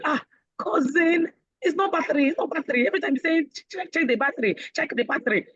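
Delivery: high-pitched mock correction; mocking, high-voiced cousin; teasing cousin. Cousins correcting her, acted out.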